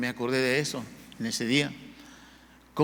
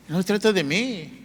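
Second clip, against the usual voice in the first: building, louder exhortation; impassioned preaching; 4 semitones higher, 4 dB louder than usual. Real, not acted. Preacher urging churchgoers to come on time, insistent preaching. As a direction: press the point home in a rising sermon voice.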